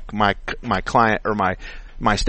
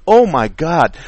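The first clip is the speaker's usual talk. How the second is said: louder and higher exclamation; emotional outburst; 5 semitones higher, 7 dB louder, slower than usual. Real, not acted. Mechanic admits being worked up, a loud high exclamation. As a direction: burst out in real frustration. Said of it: "I'm emotional about things like this".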